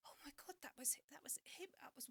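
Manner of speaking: high gasp dropping to a breathy whisper; stunned whisper. Retold or imitated. Realising the tall man was him, stunned whisper. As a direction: whisper it as if it can't be true. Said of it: "I was like".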